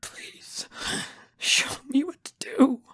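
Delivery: tearful whisper climbing high; sniffling plea. A narrator's reading. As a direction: beg in a sobbing whisper. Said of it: "I sniff".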